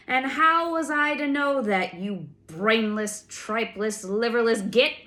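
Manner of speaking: furious insult. A narrator's reading. Hurl the insults, loud. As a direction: giant berates the sentry, loud and furious. Giant rages at sentry.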